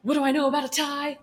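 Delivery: acted jealous retort; indignant echo. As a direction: toss the question back in mock outrage. Acted quarrel, echoing his question.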